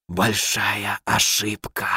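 low, slow snake-like hiss; hissing whisper. Acted. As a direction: hiss it like a serpent from the dark. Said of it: "свистящий шёпот, больше похожий на шипение змеи".

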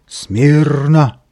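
barked command. A narrator's reading. Shaggiest nichevok calling the rowdy gang to order, barked command. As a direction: bellow it like a drill order. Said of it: "гаркнул басом".

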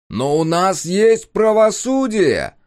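booming slow public address announcement; loudspeaker voice. A narrator's reading. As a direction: proclaim it through a loudspeaker. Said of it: "продолжал невидимый голос".

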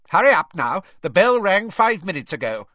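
loud barking teacher; loud sharp voice. A narrator's reading. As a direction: bark it out sharp and high. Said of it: "she barked at them".

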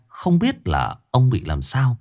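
plain reading voice

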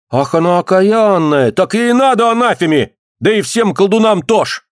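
high, vehement outcry; acted angry bystander; crowd's cursing. A narrator's reading. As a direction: condemn her to the whole square. Onlooker curses the witch.

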